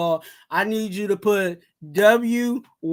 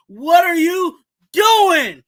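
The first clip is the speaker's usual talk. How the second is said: loud, high, slow exasperated yell; exasperated shout; 6 semitones higher, 7 dB louder, slower than usual. Real, not acted. Fan calling out Divine Deablo, exasperated shout. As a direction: throw up your hands and shout it. Ranting at a linebacker.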